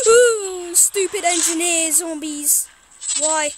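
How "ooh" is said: startled whimper. Real, not acted. Startled by zombie.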